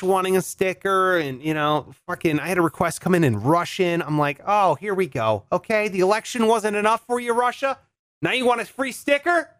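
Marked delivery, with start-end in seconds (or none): 4.5-9.5 high-pitched incredulous griping; mock indignation. Podcaster ribbing Russia over a sticker request, mock indignant. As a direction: question it in mock disbelief, pitch climbing.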